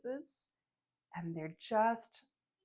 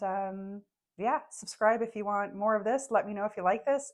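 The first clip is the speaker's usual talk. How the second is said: usual speaking voice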